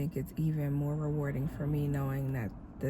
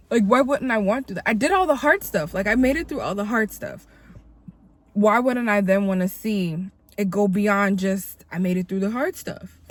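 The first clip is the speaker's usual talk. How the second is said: fired up; 4 semitones higher, 6 dB louder than usual. Real, not acted. Fired-up preaching climax.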